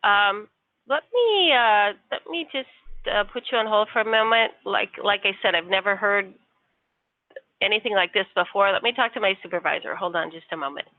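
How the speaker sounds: ordinary talk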